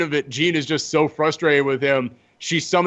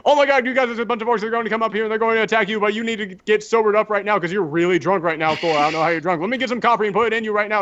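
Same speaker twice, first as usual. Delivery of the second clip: high, fast panicked babble; freaking out; 6 semitones higher, faster than usual. Retold or imitated. Jean warning drunk Thor about orcs, panicked babble. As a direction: hurry them like a panicking teen. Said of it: "she's freaking out".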